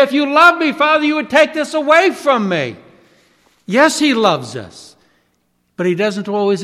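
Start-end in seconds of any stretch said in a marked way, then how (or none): 0.0-2.8 much higher, loud begging; pleading complaint. Retold or imitated. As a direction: bargain desperately with the Father. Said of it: "We say".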